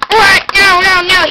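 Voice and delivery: high, loud startled no; alarmed protest. Pretend. Toy alarmed that the grown warrior is back, high and loud. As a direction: cry out in pretend alarm.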